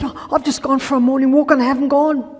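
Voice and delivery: high, loud and fast, bursting with news; a thrilled patient running up with good news; excited man. Retold or imitated. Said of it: "He was a very excited man".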